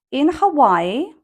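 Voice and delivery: In Hawaii with a clear rise; rising tone. Shown as a demonstration. Teacher showing the class a rising tone on In Hawaii. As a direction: stress In, lift Hawaii up. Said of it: "you can use rising tone here".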